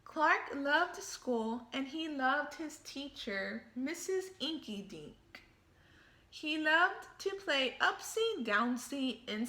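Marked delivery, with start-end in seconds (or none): none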